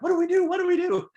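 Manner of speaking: high, loud flustered panic; mock-panic, asking for help; panicked officers. Officers at a table unsure what to do, mock panic. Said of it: "they're all like".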